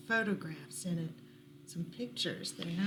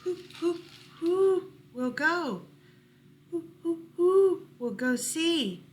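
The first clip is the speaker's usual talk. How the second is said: owl asking; 7 semitones higher, 6 dB louder than usual. Acted. Owl of many questions asking who will go, loud hooting. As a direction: hoot each who. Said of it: "asked the owl of many questions".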